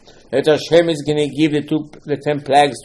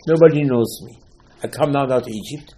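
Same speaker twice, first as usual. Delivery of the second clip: ordinary talk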